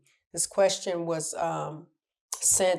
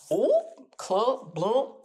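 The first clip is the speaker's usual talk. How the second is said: mock sound effect; 6 semitones higher, much slower than usual. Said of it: "whatever sound effect you can insert".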